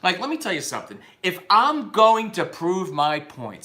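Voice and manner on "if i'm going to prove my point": slow, deliberate "I'm going to prove my point"; "prove my point" spaced out slowly and firmly; emphatic delivery. Real, not acted. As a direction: punch each word of "prove my point". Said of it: "see how I'm talking right now?".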